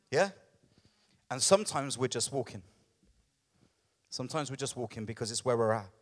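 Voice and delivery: usual speaking voice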